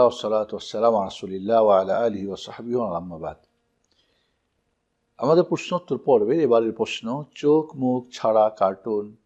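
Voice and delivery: normal talking voice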